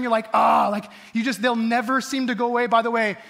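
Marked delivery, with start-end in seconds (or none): 0.3-0.7 loud, mock-exasperated "ah"; exasperated groan. Preacher imitating being driven nuts by someone, exasperated groan. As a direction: let out a fed-up groan. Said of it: "they just drive you nuts".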